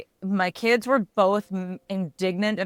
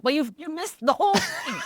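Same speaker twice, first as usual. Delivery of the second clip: teasing scold; 10 semitones higher, 3 dB louder than usual. Retold or imitated. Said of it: "part of me was like".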